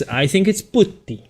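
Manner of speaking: put-on horrible Italian accent. Painter concluding on the word, high mock Italian accent. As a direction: land "putty" as an Italian POOT-tee. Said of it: "sorry for my horrible Italian accent".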